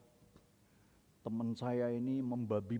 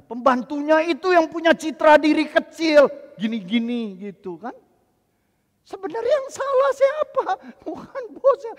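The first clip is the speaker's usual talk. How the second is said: loud, high preaching to the congregation; fiery preaching; 13 semitones higher, 18 dB louder than usual. Acted. Pastor proclaiming God's great project to congregation, fiery preaching.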